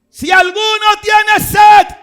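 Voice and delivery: slow, high, very loud cry; preacher voicing Jesus crying out to the crowd at the temple; Jesus shouting. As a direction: call it out over the crowd, drawn out. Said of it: "gritó, ¿eh?".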